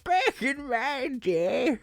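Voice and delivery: put-on high, slow old codger; out of touch boomer. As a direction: croak it like a grumpy old-timer. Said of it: "You do such a great boomer voice".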